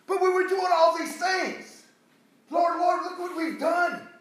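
high, loud protest to the Lord; acted pleading shout, pitched high; indignant plea. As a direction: cry out in protest, pleading your case.

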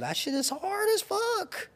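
high, slow, whiny mock sob; mock crying. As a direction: wail it like a fake cry. Complaining about hunting for girls.

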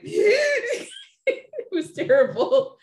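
high, loud laughing wail imitating heaving sobs; hammed-up sob-laugh, slowly drawn out; mock ugly crying. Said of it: "it wasn't pretty crying".

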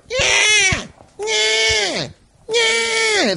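high, strained cat yowl repeated. Retold or imitated. Cat yowling through the whole house. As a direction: wail it like a cat that wants the faucet on.

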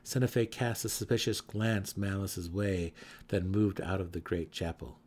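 ordinary delivery

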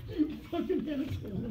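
laughing, high and soft; laughing insult. Retold or imitated. To the agent over the credit, laughing insult. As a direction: laugh it out in fond disbelief. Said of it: "I'm like".

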